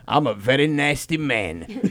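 put-on low, slow old-dad voice; imitated lecherous father. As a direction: drag it out low as the creepy father. Voicing his father in a story.

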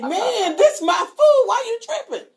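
high-pitched, hammed-up angry dad shout; mad dad. Dad objects to someone messing with his food, mock furious. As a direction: imitate an indignant dad, voice shooting way up. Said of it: "He would get mad at that".

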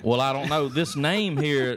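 ordinary talk, nothing marked

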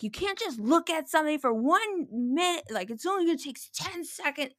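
high-pitched mock protest of a child; imitated angry yelling. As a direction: imitate an indignant kid yelling at a parent. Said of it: "yelling at me".

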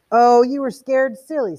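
high, loud mocking jeer; crowing taunt. A narrator's reading. Beatty jeering at scared Montag, crowing. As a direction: rub in the fright.

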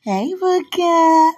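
high, slow sung-out hello; singsong greeting. Real, not acted. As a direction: stretch his name up high. Singsong call again.

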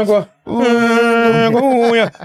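"Égua, unha" high, through a nearly closed mouth; barely open mouth. Shown as a demonstration. Showing friends how Paraenses talk, mouth barely open. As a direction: keep the lips almost shut on "Égua". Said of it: "não abre a boca pra falar".

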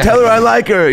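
high bashful declaration; lovestruck kid. Retold or imitated. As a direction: blurt out your crush. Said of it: "Or, like".

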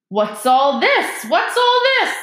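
slow, loud, rising to very high; pompous busybody. A narrator's reading. Lockhart bustles in on the crowd.

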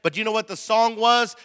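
usual speaking voice

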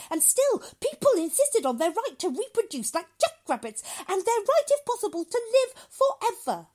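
loud, climbing high in indignation; severe lecturing doctor. Acted. Doctor rails about past overpopulation, loud and indignant.

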